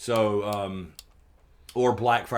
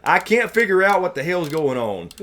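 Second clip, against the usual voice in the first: loud, high, fed-up rant; real frustration boiling over; exasperated outburst; 8 semitones higher, 8 dB louder than usual. Real, not acted. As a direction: throw up your hands at the whole mess, loud and high. Frustrated rant at own channel.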